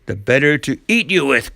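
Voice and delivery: a touch of a wolfish growl. A narrator's reading. Wolf turns on the girl, growling. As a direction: pounce on the words with mild menace. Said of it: "growled the wolf".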